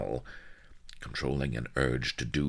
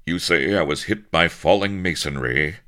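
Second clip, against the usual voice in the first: louder indignant exclamation; exclaiming; 4 semitones higher, 9 dB louder than usual. Acted. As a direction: burst out in disbelief. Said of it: "exclaimed Captain Avery".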